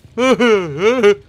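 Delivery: loud, high villain laugh; gloating cackle. Pretend. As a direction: cackle over your wounded prey. Gloats over the injured player.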